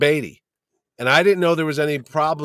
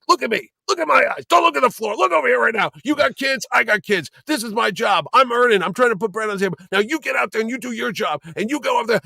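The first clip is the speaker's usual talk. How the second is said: stern scolding; 9 semitones higher than usual. Retold or imitated. Boss chewing out a worker who stares at the floor, angry. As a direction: bark orders, eyes locked on him. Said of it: "Jesus God, you were mean".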